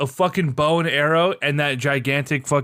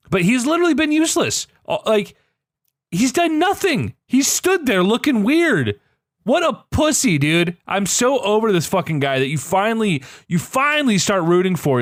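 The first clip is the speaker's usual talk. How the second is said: exasperated rant; 7 semitones higher than usual. Real, not acted. Fed-up friend ranting about Jon Snow, high exasperated voice.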